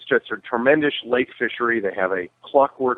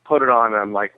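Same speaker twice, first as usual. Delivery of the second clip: ordinary delivery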